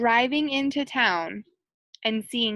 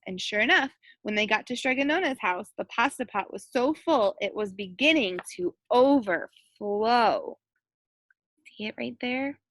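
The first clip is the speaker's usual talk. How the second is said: usual speaking voice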